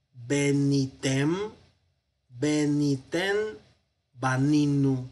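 Benitem, beniten, baninu read very slowly, syllable by syllable; slow recitation. Shown as a demonstration. Teacher reciting the plural paradigm slowly for students. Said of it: "Vamos al plural".

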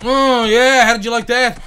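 high, loud crowing at the opponent; loud gloating taunt. Real, not acted. Gamer taunting the boxer he just hit, loud and gloating. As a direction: rub it in his face, loudly. Gloating after landing a punch.

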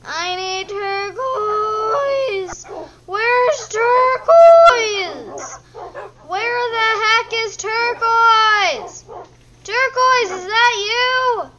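loud, high, drawn-out wail; slow, high-pitched searching cry; wailing call. Real, not acted. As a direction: stretch the words out, calling desperately. Calling for the lost pet.

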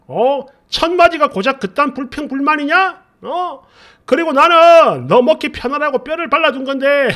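loud, heated rant that builds to a yell; mock furious husband shouting back at his wife; imitating husband's angry rant. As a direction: tear into her like a drunk, offended husband. Husband's drunken angry outburst retold.

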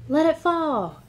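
usual speaking voice

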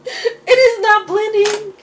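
high, loud and slow whine; real dramatic makeup wail; dramatic dismay. Real, not acted. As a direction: wail it at the mirror. Makeup won't blend, dismayed.

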